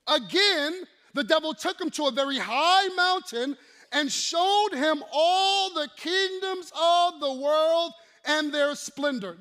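loud, high commanding quote; preaching at full force. Acted. Preacher thundering Jesus' answer. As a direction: declare it over the crowd.

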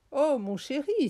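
high, loud cry to a darling; exclaimed endearment. A narrator's reading.

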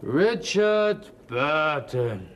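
very slow and drawn-out; dazed star. Acted. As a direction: stretch the name out in a trance. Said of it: "der junge Mann antwortet". (sehr langsam und gedehnt)

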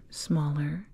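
ordinary talk, nothing marked

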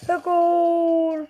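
excited high goal call; goal shout. Pretend. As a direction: yell the goal.

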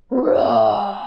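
drawn-out roaring word; lion roar. Shown as a demonstration. Teacher showing children a lion's roar. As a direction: show the kids a lion's roar. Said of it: "what noise a lion makes".

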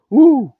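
ouh crié très fort et aigu; cri pour surprendre; cri de fantôme. Acted. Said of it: "il cria". (Gaspard crie ouh pour effrayer le professeur)

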